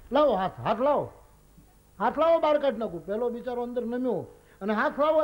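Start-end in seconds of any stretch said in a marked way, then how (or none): 0.1-3.1 loud, higher urgent call down the well. Retold or imitated. Rescuer shouting to the merchant in the well, loud and urgent. 4.7-5.2 loud, higher call. Retold or imitated. Rescuer calling to the merchant, loud and pleading.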